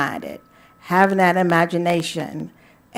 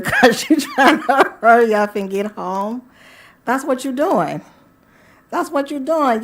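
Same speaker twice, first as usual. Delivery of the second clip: laughing through the words; 6 semitones higher, 3 dB louder than usual. Real, not acted. Joking about slipping out of church, laughing. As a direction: let the laugh break into the sentence.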